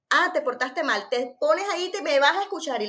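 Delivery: loud, high mock reprimand; scolding parent. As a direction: play the parent berating the child. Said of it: "le gritamos".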